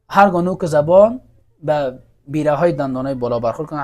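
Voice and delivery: ordinary talk, nothing marked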